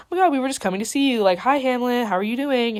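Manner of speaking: hammed-up sugary small talk; mock gushing greeting. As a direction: overdo the innocent hello. Visiting friends gush at Hamlet.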